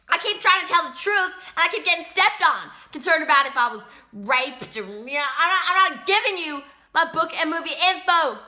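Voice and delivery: high, agitated complaint; real frustrated protest. Real, not acted. Prisoner refusing to give interviewer book material, frustrated. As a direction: push back hard, fed up.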